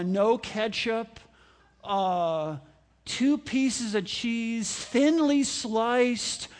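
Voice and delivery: slow, hesitant order full of pauses; slow picky order. Retold or imitated. Customer at drive-through window, slow and hesitant. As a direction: drag out every item like an indecisive customer. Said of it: "they're ordering things like".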